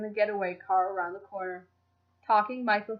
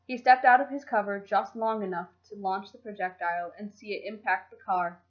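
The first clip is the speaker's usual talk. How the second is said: plain reading voice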